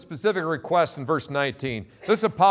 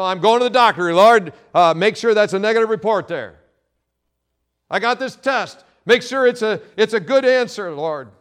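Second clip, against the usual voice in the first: mock careless prayer; 4 semitones higher, 5 dB louder than usual. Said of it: "Sometimes our prayers are passionless".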